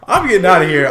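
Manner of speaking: loud mock panic about running off. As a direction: shout it as you flee. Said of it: "I'm like".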